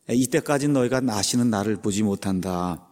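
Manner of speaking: ordinary talk, nothing marked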